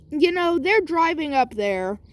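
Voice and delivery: plain reading voice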